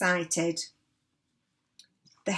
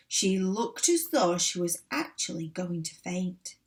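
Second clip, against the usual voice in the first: usual speaking voice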